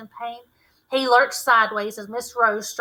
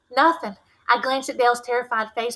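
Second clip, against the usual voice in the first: usual speaking voice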